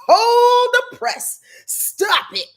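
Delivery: high, loud, slow "Hold the press"; excited outburst. Real, not acted. Host interrupting her own rundown, high excited burst. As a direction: stop everything, you're too thrilled.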